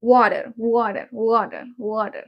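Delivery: "Water" with a soft D for the T; American T as soft D. Shown as a demonstration. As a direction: model "Water" as "wah-der" for repeating. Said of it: "Repeat after me".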